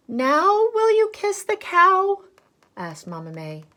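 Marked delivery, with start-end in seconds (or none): 0.1-2.2 exasperated mother. Acted. Mother asking, slow and high.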